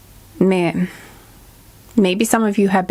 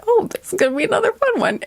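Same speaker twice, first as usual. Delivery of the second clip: bright, fast, much higher excited quote; 12 semitones higher, faster than usual. Retold or imitated. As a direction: gush like a happy surprise. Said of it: "And I was like".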